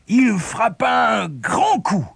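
slow, high, straining narration; effortful emphasis. A narrator's reading. Storyteller describing the great axe blow, straining. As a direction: stretch it out like a mighty swing.

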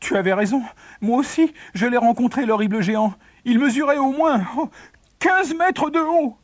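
high-pitched, awestruck alarm; frightened. A narrator's reading. Second son reporting the horrible giant, frightened. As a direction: tell it pitched right up, still scared.

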